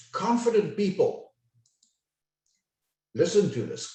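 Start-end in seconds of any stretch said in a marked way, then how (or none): 0.1-1.3 unhurried, higher-pitched emphatic opening, then a pause; assured, deliberate delivery; confident, taking time. Shown as a demonstration. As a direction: let the phrase stand on its own. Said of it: "take their time".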